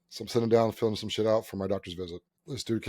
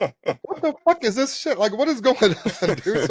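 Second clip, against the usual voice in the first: very high, slowed mock outburst; exasperated disbelief; 10 semitones higher, 4 dB louder than usual. Patient baffled by receptionists' behaviour, high-pitched disbelief. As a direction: squeal it with laughter breaking through.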